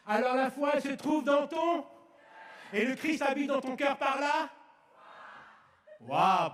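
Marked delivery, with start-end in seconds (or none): 0.1-4.5 plus haut et plus fort, prêche avec ferveur; prêche enflammé. Acted. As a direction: proclame la foi à l'assemblée. Prêche à pleine voix. (prédication fervente et sonore)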